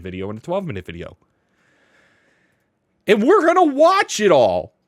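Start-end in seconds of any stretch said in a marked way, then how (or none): 3.1-4.7 loud, high excited yell; big high-pitched hype shout; hyped announcement. Real, not acted. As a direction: shout it to pump up the viewers. Hyping up tonight's line-up.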